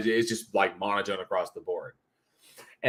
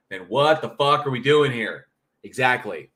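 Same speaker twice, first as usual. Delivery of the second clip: normal talking voice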